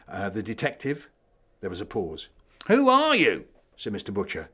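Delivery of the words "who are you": much higher, loud judge's question; who on earth are you; the judge demands to know who Charlie is, loud and high; baffled demand. Acted.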